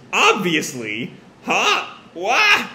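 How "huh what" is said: high, startled yelps; frozen shock. Acted. Both teens freeze at the mother's question, high shocked voices. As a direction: blurt each word high in disbelief. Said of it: "they both sort of freeze".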